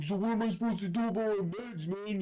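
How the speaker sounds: high-pitched imitation of a worried man; hammed-up worried whine; mock fretting. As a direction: fret in a high, helpless voice. Worried man asks cellmate, imitated.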